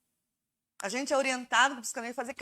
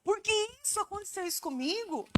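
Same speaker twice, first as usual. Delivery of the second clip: higher, self-absorbed why-me whine; narcissistic whine; 6 semitones higher, 3 dB quieter than usual. Retold or imitated. Preacher mocking a narcissistic griever, whiny why-me. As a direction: play the self-centered victim.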